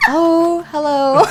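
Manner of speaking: high, loud mock-polite greeting; hammed-up sheepish phone hello; suddenly backing down. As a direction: act out anger collapsing into sweet politeness. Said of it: "I went down like this".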